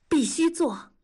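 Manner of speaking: high, emphatic decision; sudden resolve. A narrator's reading. Wife suddenly decides after long silence, resolute.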